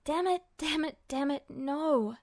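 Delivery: usual speaking voice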